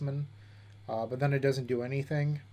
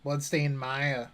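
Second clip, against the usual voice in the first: put-on slow Boston accent, Mire as Maya; drawn-out Boston accent; much slower than usual. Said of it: "you don't like my Boston accent?".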